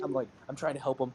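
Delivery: normal talking voice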